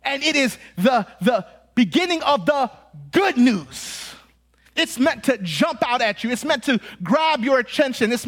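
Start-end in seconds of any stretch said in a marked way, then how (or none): 0.8-4.0 "good news" punched, each word set apart; emphatic reading. Shown as a demonstration. Preacher showing the text's emphasis, "good news" punched. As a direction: lean hard on "good news" after a pause. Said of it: "There is emphasis on certain words here".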